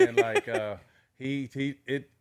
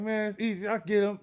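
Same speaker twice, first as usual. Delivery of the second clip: cocky boast; 9 semitones higher than usual. Retold or imitated. Retold boast to girlfriend.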